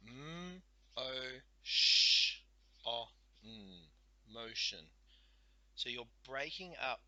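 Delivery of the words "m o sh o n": M, O, sh, o, n sounded, sh very loud; segmenting sounds. Shown as a demonstration. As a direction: stress the sh sound.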